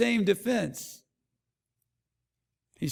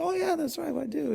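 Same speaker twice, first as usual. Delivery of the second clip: much higher, light mock-cheerful voice; imitating wife; 8 semitones higher, 4 dB quieter than usual. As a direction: chirp it like a pleased wife. Wife answering kids about purse.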